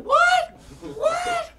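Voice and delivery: high shrieking disbelief with a laugh; screaming What in disbelief; shocked shriek. Retold or imitated. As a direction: squeal it like the news just hit. Shocked at agent's call.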